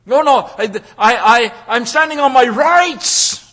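loud, high mock protest; hammed-up whine of someone demanding rights; mock indignant voice of the entitled; mocking entitled person. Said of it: "how many people today say".

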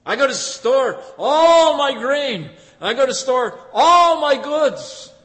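loud, high, gloating planner; a greedy farmer bragging loudly about his barns; boastful rich farmer. Acted. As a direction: crow about bigger barns. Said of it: "He said".

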